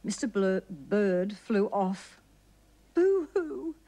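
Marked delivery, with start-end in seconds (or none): 2.9-3.7 hammed-up higher boo-hoo sob; mock sobbing boo-hoo. A narrator's reading. Mister Bird crying over his lost wife, a hammed-up sob. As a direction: fake a childish sob.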